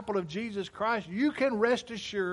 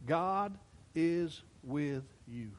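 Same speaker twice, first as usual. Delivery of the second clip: tender; 5 semitones lower, 5 dB quieter, slower than usual. Shown as a demonstration. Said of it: "he wants you to hear this today".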